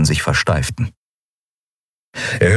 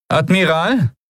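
much higher, formal officer's voice; female lieutenant voice; 11 semitones higher, slower than usual. Acted. Lieutenant announcing herself to the admiral, in a high formal officer voice.

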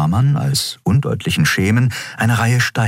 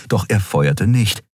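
plain reading voice

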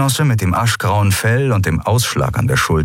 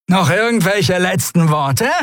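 gloating creature; 12 semitones higher than usual. Acted. Brock mocking before execution.

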